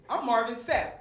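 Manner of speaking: ordinary delivery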